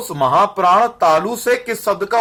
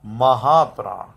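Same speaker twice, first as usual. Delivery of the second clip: slow dictation; 3 semitones lower, much slower than usual. Shown as a demonstration. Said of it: "फिर लिखो".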